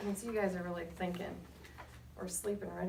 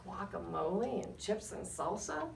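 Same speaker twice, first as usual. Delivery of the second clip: ordinary delivery, nothing marked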